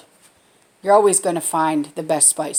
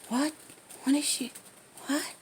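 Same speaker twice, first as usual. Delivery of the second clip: muttered under breath; 6 semitones higher, 12 dB quieter than usual. Retold or imitated. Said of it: "under his breath".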